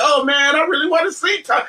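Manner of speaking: high-pitched gushing fan quote; retold excitement. Comic fan reliving his excitement before the panel, excited. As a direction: relive the eager anticipation, pitched right up. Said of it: "I was so excited Saturday".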